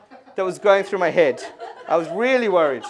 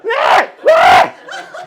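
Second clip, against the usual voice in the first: mimicked practice cries; 13 semitones higher, 11 dB louder than usual. Girl rehearsing at the mirror, fake crying. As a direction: imitate a child trying out different bawls. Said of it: "Different practice cries".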